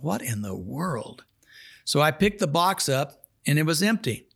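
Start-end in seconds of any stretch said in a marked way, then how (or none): 0.0-1.2 soft, slow, hushed wonder; inner bewilderment. Retold or imitated. Preacher thinking to himself, soft and slow in disbelief. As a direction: think it quietly to yourself, baffled. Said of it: "I thought".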